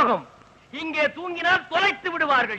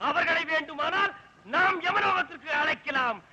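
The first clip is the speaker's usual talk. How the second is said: ordinary talk, nothing marked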